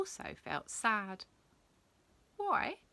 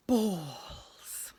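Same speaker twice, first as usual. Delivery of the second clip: whispered to himself, gleeful. Acted. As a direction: breathe the word privately. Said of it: "he said to himself".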